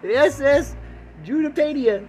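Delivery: high, loud eager questions; excited customer. Pretend. Eager customer asking about the ocean trip, high excited voice.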